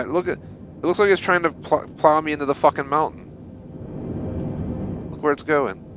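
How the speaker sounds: sudden alarm at the plane. Real, not acted. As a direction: pitch jumps up in alarm. Plane heading toward the mountain.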